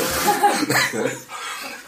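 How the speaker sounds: big high helpless laugh. Real, not acted. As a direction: burst out laughing. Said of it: "laughing myself sick".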